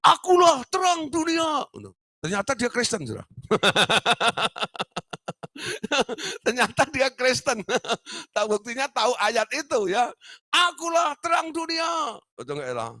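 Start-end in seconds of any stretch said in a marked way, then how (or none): 0.0-1.7 very high, slow, loud proclaiming cry; patient's proclamation. Retold or imitated. A mental patient on a table proclaims to his friends, high slow shout. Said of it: "Dia bilang begini". 10.5-12.2 patient's proclamation. Retold or imitated. A mental patient on a table proclaims to his friends, high slow shout. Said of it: "dia ngomong gitu terus".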